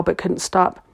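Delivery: ordinary delivery, nothing marked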